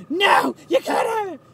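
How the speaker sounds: very high, loud drawn-out yell; hammed-up high-pitched refusal; mock possessive shout. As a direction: imitate a man refusing to share, shrieked and stretched. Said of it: "He's like".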